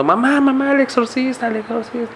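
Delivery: put-on high, panicked child calling for mom; mock a scared little brother crying out for mother; retelling a frightened kid's wail, high and thin; imitating a crying child. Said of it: "se levantaba luego llorando y gritando".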